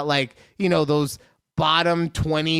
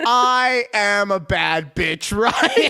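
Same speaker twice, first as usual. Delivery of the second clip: slow, drawn-out mock diva declaration; sassy boast breaking into a laugh; hammed-up sassy quote; 3 semitones higher, 4 dB louder, much slower than usual. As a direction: stretch out each word with playful swagger. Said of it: "what words rang through my head".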